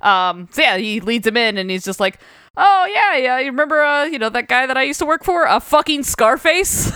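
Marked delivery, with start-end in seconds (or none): 2.6-6.9 a hint of a chummy gangster, higher; casual boast, pitched up; lightly voiced mobster. Mobster tells doorman about his old boss, lightly higher.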